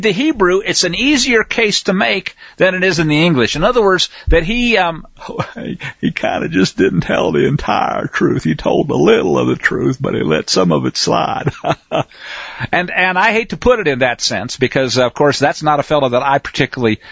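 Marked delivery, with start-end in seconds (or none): 5.5-12.0 talking through a suppressed laugh; amused chuckling. Real, not acted. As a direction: let a chuckle ride under the words. Amused at his own euphemism.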